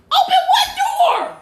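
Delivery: high-pitched, incredulous shout; panicked yell. Real, not acted. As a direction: yell it back in disbelief, pitch way up. Panicked reply about trapped bird.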